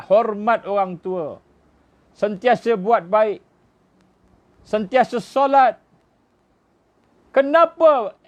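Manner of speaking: climbs from steady to high, loud, slow indignant cry; building outcry. Acted. Preacher asks the congregation why he was killed, rising to an anguished shout. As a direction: build each line until the question bursts out.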